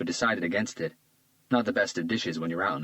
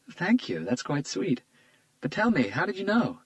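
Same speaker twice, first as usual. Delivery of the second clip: put-on lightly raised, charmed young woman; a flattered young woman, a bit higher; Isabella's voice; 11 semitones higher than usual. A narrator's reading. Isabella charmed by Hunter's compliment, lightly higher voice.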